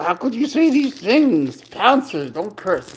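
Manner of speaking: high, drawn-out mock dismay; mock scandalized. Owner scolding cat for cursing, mock scandalized.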